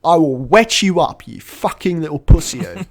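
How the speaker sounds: loud, high mock-furious threat; hammed-up shouted menace; crazy aggressive cop. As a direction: shout insults like an overblown bully cop. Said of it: "like, crazy aggressive".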